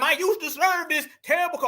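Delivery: high-pitched mock rant; mocking imitation. Host parodies the ex-military woman's rant, mocking.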